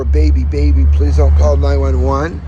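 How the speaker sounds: loud pleading. Retold or imitated. Retold desperate begging.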